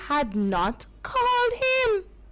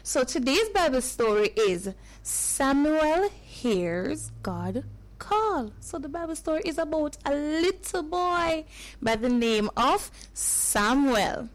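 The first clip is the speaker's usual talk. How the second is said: usual speaking voice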